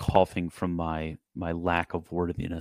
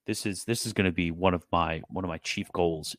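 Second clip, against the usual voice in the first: ordinary talk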